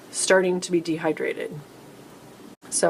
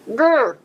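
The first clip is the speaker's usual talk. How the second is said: mock frustrated growl; 6 semitones higher, 8 dB louder than usual. Running out of stream time.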